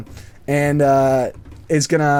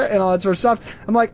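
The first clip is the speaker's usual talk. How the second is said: ordinary talk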